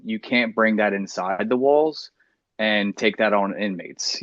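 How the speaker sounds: ordinary talk, nothing marked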